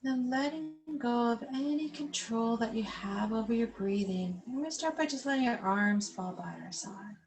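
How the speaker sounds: calm, unhurried yoga instructor cueing the breath; guided breathing. Acted. Yoga teacher guiding seated class through belly breathing, calm guided voice.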